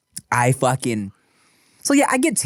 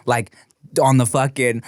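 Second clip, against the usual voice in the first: ordinary talk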